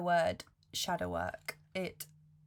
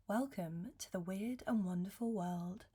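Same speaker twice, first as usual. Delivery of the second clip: ordinary talk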